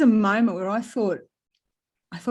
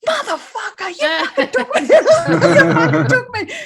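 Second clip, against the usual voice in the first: high, loud laughing yell; laughing outburst; 14 semitones higher, 10 dB louder than usual. Retold or imitated. Realizing in the shower they were taken, laughing shout. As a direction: crack up as you shout it. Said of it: "And I went".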